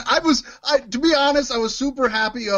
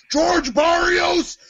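mock boss firing someone; 6 semitones higher, much slower than usual. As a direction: play the boss who fires the executive on the spot. Playing boss firing an executive.

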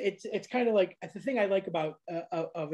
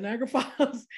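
fast, higher, with a laugh breaking in; amused insistence, laughing; 7 semitones higher, much faster than usual. Real, not acted. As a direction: giggle through the place name. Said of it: "I wasn't lying".

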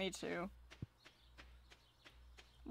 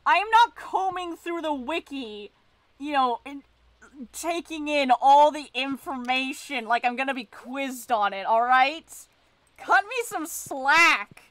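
raised, emphatic protest; worked up; 4 semitones higher, 8 dB louder than usual. Real, not acted. Player tells chatter they skip the wiki, worked up. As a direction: plead your case loudly and indignantly. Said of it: "for getting worked up".

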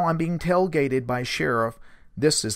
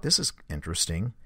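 low, softer retold musing; inner thought; 7 semitones lower, 6 dB quieter than usual. Source thinking to himself during the questioning, low inner thought. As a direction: drop it to a private thought. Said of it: "he was just thinking this".

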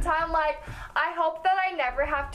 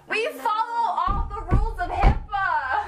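mock official; 7 semitones higher, 4 dB louder than usual. Mock solemn joke.

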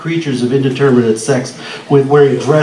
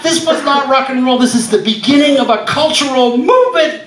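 much higher, standing on the desk preaching; fervent plea; 9 semitones higher than usual. Retold or imitated. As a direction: proclaim it like a revelation to the boss. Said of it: "and I was going".

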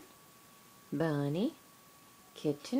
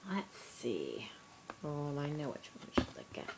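whisper; 7 semitones lower, 8 dB quieter, faster than usual. Real, not acted. Whispering while reading to baby.

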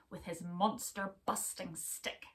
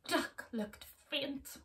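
ordinary delivery

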